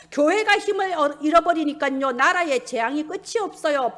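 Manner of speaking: normal talking voice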